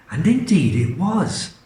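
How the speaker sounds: ordinary delivery, nothing marked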